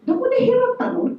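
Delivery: high fast mock amazement; teasing question. In-laws teasing son about his foreign wife, high. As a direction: tease like an in-law. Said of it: "in-laws' remark retold".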